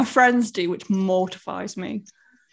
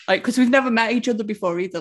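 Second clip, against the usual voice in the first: usual speaking voice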